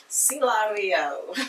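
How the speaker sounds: drawn-out name. Retold or imitated. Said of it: "I was like".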